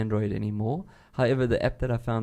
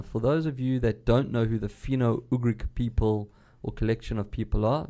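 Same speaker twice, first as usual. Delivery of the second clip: ordinary talk, nothing marked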